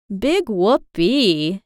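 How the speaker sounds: slow, drawn-out sarcastic sigh; a sighing, mock-thrilled whoopee. A narrator's reading. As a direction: let the air out, unimpressed. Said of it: "she sighed".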